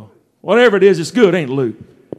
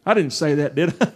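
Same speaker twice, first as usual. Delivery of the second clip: quiet aside; 5 semitones lower, 6 dB quieter than usual. Real, not acted. Playful retraction after spoiled babies jab.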